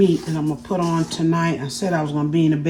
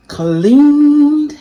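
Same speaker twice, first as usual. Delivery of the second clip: proud; 10 semitones higher, 10 dB louder than usual. Real, not acted. Proudly showing the cleaned chicken.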